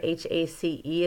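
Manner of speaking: ordinary talk, nothing marked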